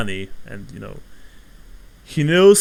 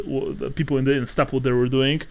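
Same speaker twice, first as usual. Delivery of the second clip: ordinary talk, nothing marked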